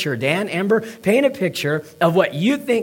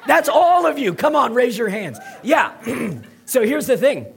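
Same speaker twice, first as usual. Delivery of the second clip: laughing; 6 semitones higher than usual. Real, not acted. Laughing at his bum joke.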